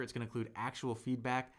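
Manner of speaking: ordinary delivery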